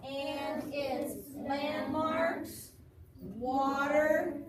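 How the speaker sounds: very slow, loud read-along with rising ends; voices together. Shown as a demonstration. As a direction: stretch each word and leave it hanging. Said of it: "so voices together".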